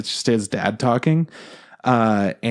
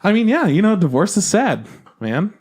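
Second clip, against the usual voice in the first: mock pity; 9 semitones higher than usual. Mock sympathy while teasing co-host.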